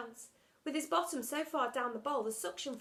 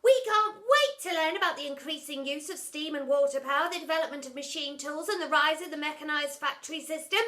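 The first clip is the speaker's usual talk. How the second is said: higher, louder mock-eager exclaim; 5 semitones higher, 9 dB louder than usual. Mungo mocking teacher, exaggerated eagerness. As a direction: gush with fake enthusiasm. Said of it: "exclaimed Mungo".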